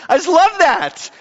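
high, laughing joy; real laugh of delight. Real, not acted. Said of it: "laughs while saying".

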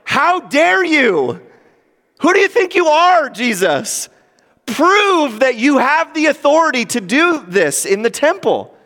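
high, loud, outraged demand; indignant challenge. Retold or imitated. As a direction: accusers crying out in protest. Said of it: "Like, essentially, they're like".

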